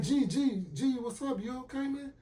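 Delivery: high-pitched imitation of a friend checking in; concerned friend. Friend breaks up the call, imitated high concern.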